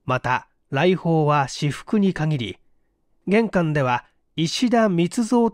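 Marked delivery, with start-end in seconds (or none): none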